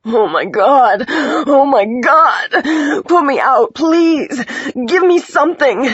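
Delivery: loud, high, sobbing pleas; crying. A narrator's reading. Injured woman begging to be put out, crying. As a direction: wail and beg through tears. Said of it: "who was crying, her voice guttural, unrecognizable".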